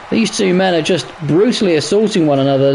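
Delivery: ordinary talk, nothing marked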